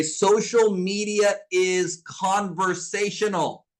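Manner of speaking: slow, word by word, for note taking; slow dictation. Shown as a demonstration. Coach dictating a rule to her students, slow and deliberate. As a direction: spell it out slowly so they can write it down. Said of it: "Write this down in your notes right now".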